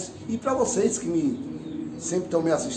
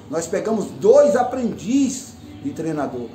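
ordinary talk

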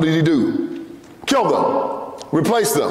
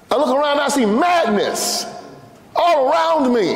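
excited; 7 semitones higher than usual. Real, not acted. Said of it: "I'm getting a little excited here".

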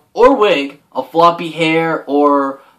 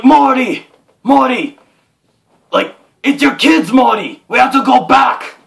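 frantic film scientist, raised pitch; Doc Brown; 7 semitones higher, 3 dB louder than usual. Acted. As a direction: call out urgently, higher and louder, in character. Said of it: "Emmett Brown figure!".

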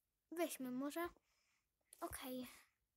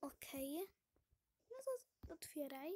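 ordinary delivery

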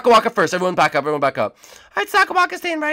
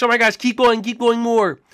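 usual speaking voice

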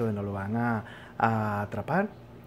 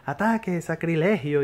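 higher, louder alarmed cry; mock outcry; 5 semitones higher, 3 dB louder than usual. Old monk yells at the intruders, hammed-up alarmed cry. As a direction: raise the alarm like a scandalised monk.

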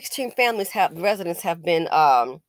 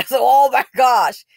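loud laugh-filled exclaim; real laughing; 3 semitones higher, 8 dB louder than usual. Real, not acted. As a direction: burst out laughing. Laughing at the insult.

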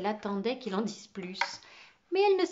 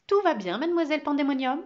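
aboiement autoritaire; 5 semitones higher, 7 dB louder, faster than usual. Acted. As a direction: question lancée fort et sec. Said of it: "aboya-t-il". (question aboyée, plus forte)

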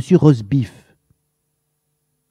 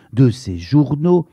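ordinary delivery, nothing marked

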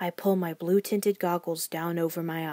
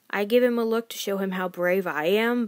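usual speaking voice

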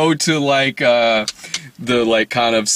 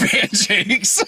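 laughing; 8 semitones higher than usual. Real, not acted. Echoing pancakes.

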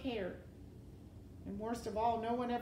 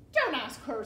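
loud, raised interruption; urgent order; 4 semitones higher, 10 dB louder than usual. A narrator's reading. Rat stopping the princess's question, loud and urgent. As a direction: cut in loudly to stop it.

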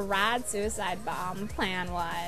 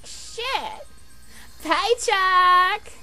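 high, loud fist-shaking yell; hammed-up cursing shout; mock cursing; 8 semitones higher, 5 dB louder, much slower than usual. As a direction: cry out in exaggerated vexation at a name. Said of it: "I said, all shaking my fist".

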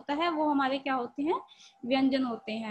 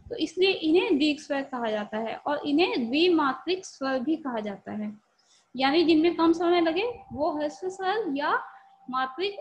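ordinary talk

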